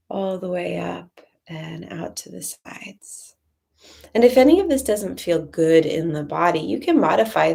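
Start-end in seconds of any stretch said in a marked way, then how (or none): 0.1-3.3 slow, soft, near-whispered guidance; hushed breath cue. Real, not acted. Teacher guiding arms and breath up and out, hushed and slow. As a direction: let the words thin out into a slow whisper.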